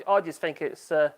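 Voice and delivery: normal talking voice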